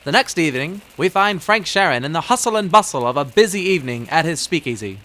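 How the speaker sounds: normal talking voice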